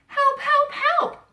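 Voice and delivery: high, loud cries for help; high loud panicky help; crying for help. A narrator's reading. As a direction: call out desperately. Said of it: "cried the Little Blue Truck".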